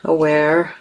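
usual speaking voice

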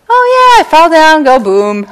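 put-on high, loud casual child voice; easygoing kid. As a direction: shrug it off like a kid. Child shrugging off the fall.

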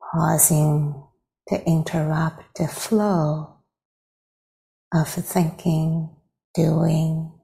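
unhurried phrases with long silences between; guided meditation. Real, not acted. Teacher leading meditators into arriving with the breath, guided meditation. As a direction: guide gently, leaving space after each phrase.

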